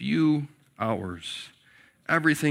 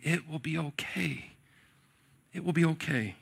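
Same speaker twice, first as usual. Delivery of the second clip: put-on soft, hushed, tender comfort to a child; tender reassurance; 2 semitones higher, 7 dB quieter than usual. Mom on the phone soothing her little boy, soft and tender. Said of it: "Speaking tenderly and compassionately".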